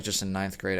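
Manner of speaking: usual speaking voice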